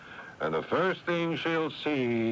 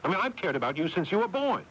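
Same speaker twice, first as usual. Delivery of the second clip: higher, fast indignant burst; angry retort; 6 semitones higher, faster than usual. Acted. Older brother hitting back at his accusing brother, indignant. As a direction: snap back, fed up.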